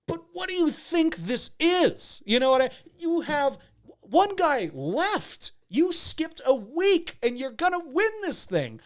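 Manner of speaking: high, slow, incredulous rant; exasperated scolding. Pretend. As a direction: drag out each point in high-pitched disbelief. Host scolds the absent suitor.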